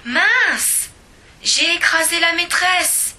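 high, loud sudden alarm; a startled high yelp; shocked cry. A narrator's reading. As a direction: blurt it out in horror. Squashed the teacher.

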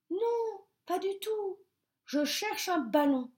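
high protesting denial. A narrator's reading. Bear protesting to the stork, high and pleading. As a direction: insist he's innocent. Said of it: "protesta Petit Ours mal peigné".

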